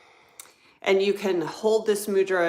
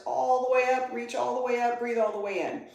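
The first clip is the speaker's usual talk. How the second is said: higher, held-level pitch through the reach; sustained inhale cue; 6 semitones higher than usual. Real, not acted. Yoga teacher cueing students to breathe all the way in, sustained high tone. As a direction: draw them upward on one high, held tone.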